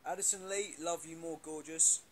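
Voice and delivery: loud shout-out. Real, not acted. Shouting out to a fan.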